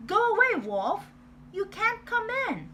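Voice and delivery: high loud pig voice shouting back; pig yelling. Acted. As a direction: shout like a small angry pig. Pig yells back at wolf.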